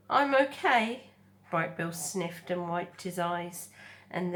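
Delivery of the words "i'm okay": tearful gosling voice. Acted. Gosling after crying.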